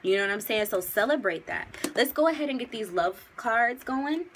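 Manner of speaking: ordinary delivery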